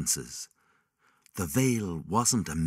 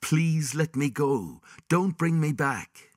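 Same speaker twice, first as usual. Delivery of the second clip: pleading cry; 9 semitones higher, 3 dB louder than usual. Acted. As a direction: beg to be let go. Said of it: "let loose a searing cry".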